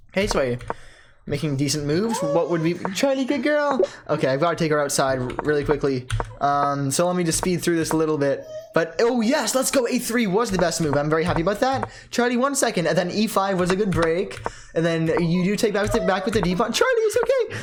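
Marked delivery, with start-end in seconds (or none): 2.9-3.8 higher cooing pet voice; dog talk. Real, not acted. Owner praising dog Charlie mid-video, higher pet voice. 16.7-17.6 very high laughing pet voice; dog talk. Real, not acted. Owner laughing while calming dog Charlie, very high pet voice.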